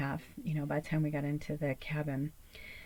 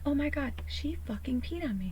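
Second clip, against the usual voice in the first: horrified; 9 semitones higher than usual. Retold or imitated. Reacting to being peed on.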